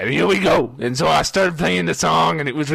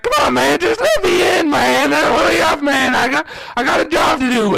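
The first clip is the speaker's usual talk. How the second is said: loud, high-pitched begging; acted-out frantic whine; desperate pleading; 11 semitones higher, 4 dB louder than usual. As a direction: beg urgently to be let through the door. Begging bouncer to let him in.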